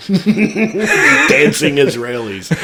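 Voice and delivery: giggling through it; laughing. Real, not acted. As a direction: laugh while saying it. Laughing joke.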